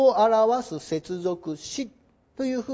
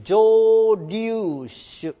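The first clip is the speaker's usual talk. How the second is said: drawn-out term; 5 dB louder, much slower than usual. Shown as a demonstration. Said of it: "drawn-out key term".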